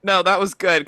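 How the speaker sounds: ordinary talk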